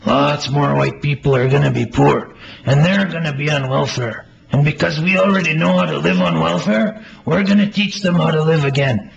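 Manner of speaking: high, loud, eager voice. Acted. Playwright voices the eager friend reassuring Melvin, high and loud. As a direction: answer like it's obvious, bursting with certainty.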